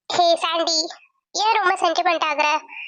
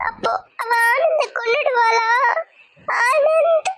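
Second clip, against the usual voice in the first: crying out; 3 semitones higher, slower than usual. Acted. Bride fears husband will die.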